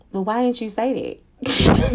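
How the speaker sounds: surprised. Retold or imitated. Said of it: "And I'm just like".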